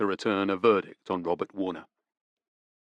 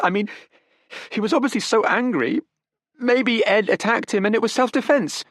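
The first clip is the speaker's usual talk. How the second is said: put-on higher, urgent young woman pleading; desperate young woman; 11 semitones higher, 3 dB louder than usual. Daughter defends her father to detectives, desperate. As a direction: grasp for excuses, pitched up. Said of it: "she suggested desperately".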